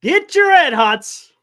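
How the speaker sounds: loud drawn-out vendor call; slow, loud ballpark hawker cry; stadium vendor hawking. Retold or imitated. Joining the vendor imitation.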